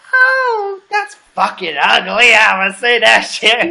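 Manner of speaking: high, loud, half-crying "ew" at the photo; teasing fake outrage, voice cracking high; mock horrified outburst. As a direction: ham up a friend shrieking in disgust. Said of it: "Trish is like".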